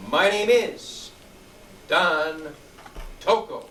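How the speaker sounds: MY NAME IS loud and drawn out, then pauses round Don; strong start. Shown as a demonstration. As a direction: punch out My name is, pause before each name. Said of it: "with a strong start".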